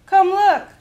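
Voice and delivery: a high, very loud call; children shouting. Acted. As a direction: shout for everyone to come see.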